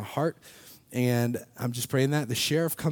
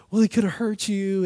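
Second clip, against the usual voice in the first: worried mom; 8 semitones higher, 5 dB louder than usual. Retold or imitated. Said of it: "my mom is, like, breaking down".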